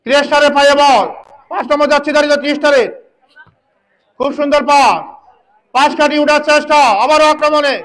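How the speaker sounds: fast excited match commentary; frantic football commentary. Real, not acted. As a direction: call the action live, urgently. Live play-by-play commentary.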